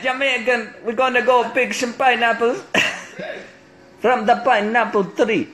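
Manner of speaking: high, doubtful mock Jamaican; Jamaican accent. Shown as a demonstration. Announcing a pineapple-picking trip, high put-on Jamaican. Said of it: "I don't even know if that was Jamaican".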